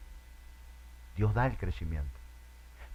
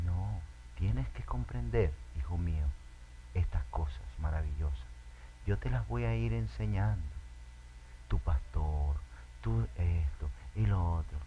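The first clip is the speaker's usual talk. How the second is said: tender fatherly voice; 5 semitones lower, 7 dB quieter than usual. Acted. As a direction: coax a child gently. God speaks tenderly to child. (padre tierno y suave)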